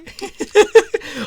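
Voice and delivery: loud high giggle; breaking up again; high laugh. Real, not acted. Gamer laughing about the character's whoa.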